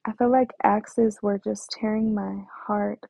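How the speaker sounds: ordinary talk, nothing marked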